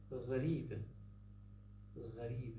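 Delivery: غريب with a voiced throaty GH; Arabic ghain sound. Shown as a demonstration. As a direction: start غريب with a gargled uvular fricative. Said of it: "in Arabic".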